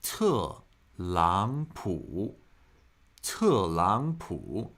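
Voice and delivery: 特朗普 drawn out very slowly, syllable by syllable; slow, low teacher demo of 特朗普 for copying; repeat-after-me demo. Shown as a demonstration. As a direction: model 特朗普 slowly with falling, rising, dipping tones. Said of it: "Listen and try to mimic me".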